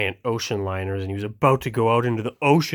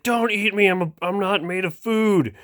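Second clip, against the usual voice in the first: scared boat voice; 8 semitones higher than usual. Acted. Boat pleads with the cow.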